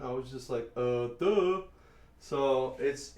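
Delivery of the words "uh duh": mock-dim, higher and louder; self-mocking dopey voice; dumbfounded dope. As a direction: play up being clueless, drawing out the duh. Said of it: "I totally derfed that one".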